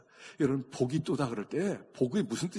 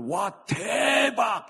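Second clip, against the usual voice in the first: high, loud, drawn-out wow; student's amazement; 9 semitones higher, 6 dB louder, much slower than usual. Acted. Students reacting to huge news, amazed exclamation. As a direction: gush it slowly like a stunned student. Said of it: "여기서 학생들".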